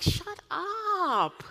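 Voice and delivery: hammed-up shut up; mock snap. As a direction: bat it back like an annoyed teen. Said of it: "he knows I would be like".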